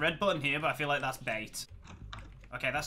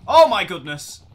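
much louder panicked cry; alarmed shout; 4 semitones higher, 16 dB louder than usual. Real, not acted. Player on a wild minecart ride, shouting in alarm. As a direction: yell it out in shock.

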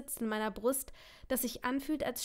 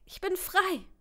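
elated; 9 semitones higher, 5 dB louder, slower than usual. Acted. Relief after he leaves.

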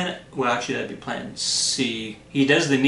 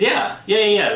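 eager yeahs; 8 semitones higher, 6 dB louder than usual. Acted. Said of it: "Hercules says".

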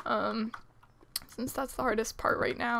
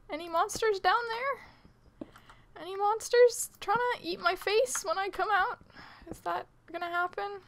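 squeaky scared call down the hole; high nervous calling; 8 semitones higher than usual. Real, not acted.

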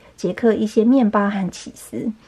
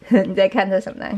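usual speaking voice

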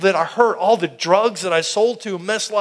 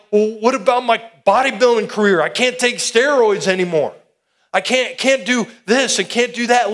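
higher, louder fretting about his career, retold; anxious protest; 5 semitones higher, 4 dB louder than usual. Preacher recalls dreading giving up steroids, higher anxious protest. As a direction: sound torn, holding on to the old life. Retelling worry over bodybuilding.

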